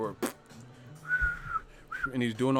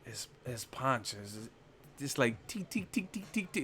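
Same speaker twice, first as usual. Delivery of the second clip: mock Golovkin's quiet taunt; quoting Golovkin; 10 dB quieter than usual. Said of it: "He says".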